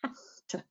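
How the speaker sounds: whispered "have to" as "haf tuh"; weak form. Shown as a demonstration. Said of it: "we end up with an F sound".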